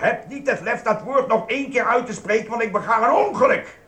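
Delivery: high, loud furious outburst; furious threat. Acted. Shop clerk yells at the customer, furious. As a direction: explode at the very word.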